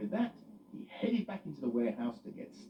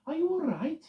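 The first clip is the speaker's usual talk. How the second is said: concerned Loretta; 7 dB louder than usual. Acted. Loretta voiced.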